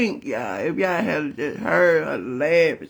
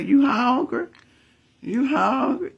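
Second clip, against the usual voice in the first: imitating grandma; 7 semitones higher, slower than usual. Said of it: "first thing she gonna say".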